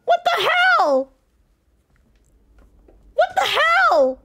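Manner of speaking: real fear, voice up high and strained; panicked high cries. Real, not acted. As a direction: cry out helplessly while being chased. Fighting monster, panicking.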